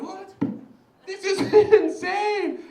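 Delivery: high-pitched incredulous outburst; mock disbelief. Viewer reacting to sofa-eating video, mock disbelief. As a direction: shoot the pitch way up, stretch the last word.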